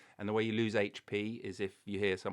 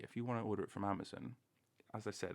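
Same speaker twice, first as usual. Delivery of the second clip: ordinary talk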